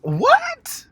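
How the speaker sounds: mock outraged what; squeaky shocked bro what; incredulous. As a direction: pitch leaps high on what, in disbelief. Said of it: "I'm just like".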